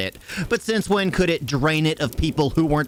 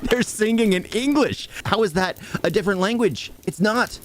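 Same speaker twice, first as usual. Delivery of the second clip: higher-pitched, incredulous protest; exasperated; 7 semitones higher than usual. Real, not acted. Reviewer mocking the film's language logic, exasperated. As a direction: rant in exasperated disbelief.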